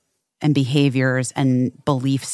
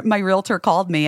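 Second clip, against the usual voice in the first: ordinary delivery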